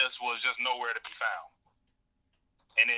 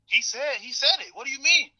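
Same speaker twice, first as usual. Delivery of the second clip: excited crowd voice; 11 semitones higher than usual. Retold or imitated. Crowd voiced reacting to argument.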